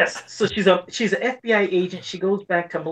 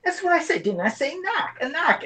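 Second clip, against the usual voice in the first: indignant Bostonian, pitched much higher; Boston accent; 10 semitones higher than usual. Retold or imitated. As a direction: insist in a high, R-dropping Boston accent. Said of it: "'cause of the damn accent".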